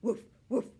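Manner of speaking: high, loud barking imitation; dog bark. Poppy barking at the door, loud woofs. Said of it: "Sometimes she even barked".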